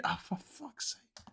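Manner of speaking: slow, breathy, exasperated curse under the breath; whispered exasperation. Real, not acted. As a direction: mutter it to yourself in despair. Exasperated aside mid-rant.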